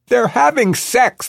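loud, very high announcement; smug announcement. A narrator's reading. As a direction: broadcast it smugly to everyone. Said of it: "smugly announces to anyone who will listen".

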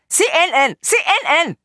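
high, excited-sounding crowd call; shouting. A narrator's reading. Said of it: "when they shout to one another".

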